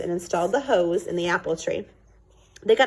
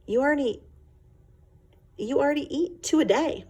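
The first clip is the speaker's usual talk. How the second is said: mild mother voice; 8 semitones higher than usual. Acted.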